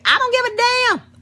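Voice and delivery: high exasperated "I don't give a damn"; mock outburst. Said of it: "repeats the line much lower".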